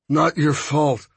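slow, through clenched teeth; gritted teeth. Acted. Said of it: "Hondo said through gritted teeth".

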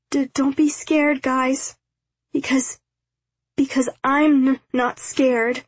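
put-on high, stammering, trembling little boy; a frightened kid trying to sound brave; quavering scared child. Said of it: "that quavering voice that seemed to run in the family".

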